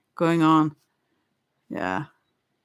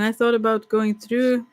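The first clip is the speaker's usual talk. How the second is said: ordinary talk, nothing marked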